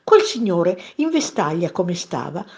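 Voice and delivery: usual speaking voice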